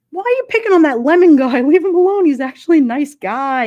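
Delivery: hammed-up protest at the chat; mock outrage. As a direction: stick up for him, loud and half-joking. Defends the lemon guy to chat.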